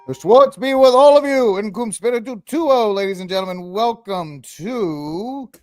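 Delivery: big hammy emcee greeting; show announcer intro. Acted. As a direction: open the show with booming, stretched-out fanfare. Show host's opening announcement.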